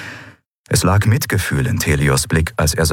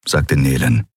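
usual speaking voice

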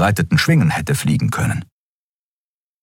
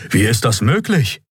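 higher-pitched puzzled question; baffled hoot; 5 semitones higher than usual. A narrator's reading. First officer questions his captain, baffled. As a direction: ask it in mild bewilderment. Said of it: "Der erste Offizier tutete verblüfft".

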